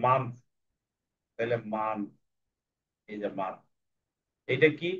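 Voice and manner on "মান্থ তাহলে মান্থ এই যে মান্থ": মান্থ repeated, softer each time; modelling মান্থ. Shown as a demonstration. Teacher models মান্থ for children, fading softly. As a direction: say মান্থ gently and fade. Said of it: "একটা মাসের ছবি".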